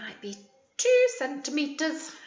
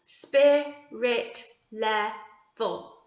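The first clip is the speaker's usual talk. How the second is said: very slow, each syllable apart; syllables counted out slowly; counting syllables; much slower than usual. Shown as a demonstration. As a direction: stretch it out to count the beats. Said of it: "Let's count how many syllables".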